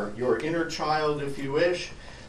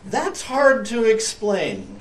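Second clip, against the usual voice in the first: sleepy cat voice; 7 semitones higher, 6 dB louder, slower than usual. Acted. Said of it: "she blinked".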